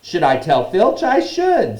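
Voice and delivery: high, loud, mock-pious imp; saintly voice. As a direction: play falsely virtuous in a high voice. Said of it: "in a saintly voice".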